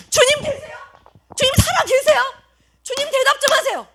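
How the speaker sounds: high-pitched plea to God; frustrated pleading prayer. Acted. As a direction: beg for an answer as a worn-out believer. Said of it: "라고 말하고 있는 사람들에게".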